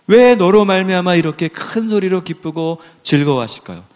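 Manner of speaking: usual speaking voice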